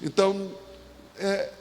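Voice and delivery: ordinary delivery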